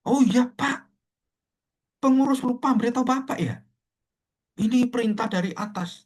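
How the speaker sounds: very high, put-on excusing voice; flustered committee member. Committee member excuses forgetting to tell elder, high mock fluster.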